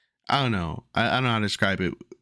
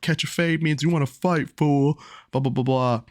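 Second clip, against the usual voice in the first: mocking kids; 6 semitones higher than usual. Kids mocking him, laughing.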